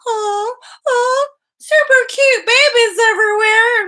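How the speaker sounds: very high, loud squealed coo; hammed-up squeal of delight; mock baby-gushing. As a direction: imitate someone swooning over babies. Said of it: "I never went down that route into, like".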